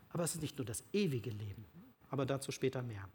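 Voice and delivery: normal talking voice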